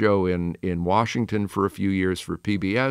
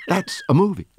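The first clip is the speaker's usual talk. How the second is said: high, hammed-up actor impression; William Shatner; 10 semitones higher, 4 dB louder than usual. Actor declaring his friend's story a film, hammed-up high impression. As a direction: play it as an exaggerated dramatic pronouncement. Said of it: "I'll do it for the hell of it".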